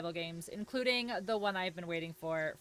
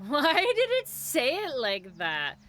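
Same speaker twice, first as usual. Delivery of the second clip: loud, higher, slow and incredulous; amused exclamation; 6 semitones higher, 8 dB louder, slower than usual. Real, not acted. Streamer baffled by how the alert said it, amused exclamation. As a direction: stretch it out loudly with a laugh in it. Repeats the question, louder, amused.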